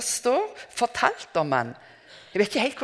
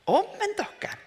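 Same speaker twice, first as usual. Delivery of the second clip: profetisk rop; 14 semitones higher than usual. Retold or imitated. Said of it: "han ropte".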